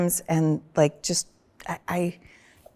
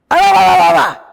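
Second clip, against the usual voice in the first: high, loud angry babble; mock angry shout; 13 semitones higher, 14 dB louder than usual. Mimicking her angry yelling back at husband in the car. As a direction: yell it right back in a rage. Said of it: "And I went".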